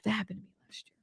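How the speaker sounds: soft unvoiced whisper. Real, not acted. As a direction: breathe it as a secret. Whispered aside.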